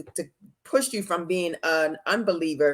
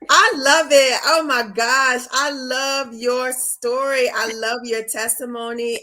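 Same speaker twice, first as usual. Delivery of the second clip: delighted praise; 4 semitones higher, 6 dB louder than usual. Real, not acted. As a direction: gush over it, stretching the words. Listener praises the testimony.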